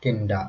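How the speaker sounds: किंडा said as one quick word; clipped casual form. Shown as a demonstration. Teacher showing students the spoken short form. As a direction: run kind of together into किंडा. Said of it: "आप इसको बोल देते हैं".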